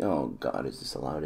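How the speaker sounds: ordinary delivery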